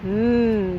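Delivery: ordinary delivery, nothing marked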